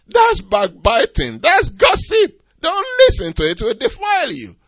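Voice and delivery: loud, high pulpit rebuke; preaching at full force. Acted. Preacher rebuking gossip before the congregation, loud. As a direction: thunder against gossip with a laugh.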